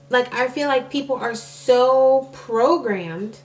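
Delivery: normal talking voice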